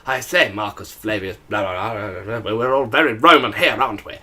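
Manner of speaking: put-on a light send-up of the British accent of a Hollywood Roman; mock posh British Roman. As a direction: tease a film Roman in a put-on upper-class English accent. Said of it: "But they're always there like".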